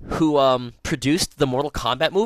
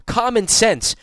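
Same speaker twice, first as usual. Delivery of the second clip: worked up; 7 semitones higher, 5 dB louder, slower than usual. Real, not acted. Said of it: "I agree completely".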